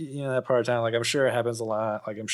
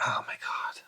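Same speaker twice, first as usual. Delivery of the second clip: breathy whispered exclamation; under the breath; hushed dismay; 8 dB quieter than usual. Retold or imitated. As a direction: whisper it in disbelief. Exasperated retold reaction.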